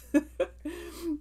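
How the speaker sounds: very high squealing giggle; giddy squeal laugh. Real, not acted. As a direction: squeal with embarrassed delight. Fangirling over her dogs.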